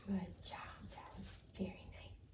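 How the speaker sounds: soft whispered "good job"; whispered praise. Real, not acted. Trainer soothing the dog with whispered praise. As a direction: whisper praise to keep her calm.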